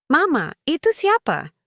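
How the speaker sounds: put-on high-pitched little girl's question; child's voice. A narrator's reading. Young daughter asks her mother about a stranger, high child's voice. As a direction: lift it high like a puzzled child.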